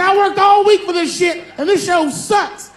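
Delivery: high, loud furious shout; angry heckler. Retold or imitated. A heckler screaming at the comedian, high loud shout. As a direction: yell it like an outraged fan. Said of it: "She said".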